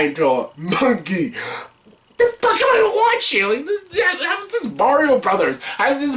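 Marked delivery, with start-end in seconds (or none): 2.2-6.2 high disbelieving shriek; incredulous. Real, not acted. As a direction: shriek in disbelief, much higher. Disbelief at the Mario movie.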